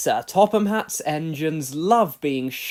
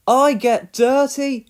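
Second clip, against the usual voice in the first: high, slow, whiny complaint; a small engine grumbling about dirt; complaining; 8 semitones higher, 10 dB louder, slower than usual. A narrator's reading. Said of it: "he complained".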